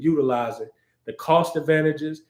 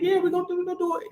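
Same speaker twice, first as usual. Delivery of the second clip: much higher, excited mock-girly voice; squeaky eager yes; much faster than usual. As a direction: pipe up high and thrilled. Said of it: "You're gonna be like".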